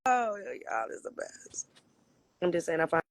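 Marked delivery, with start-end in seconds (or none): 0.1-1.7 slow, breathy whisper to the chat. Real, not acted. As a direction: whisper it fondly, slowly. Fond whisper after laughing.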